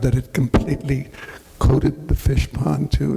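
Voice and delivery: ordinary talk, nothing marked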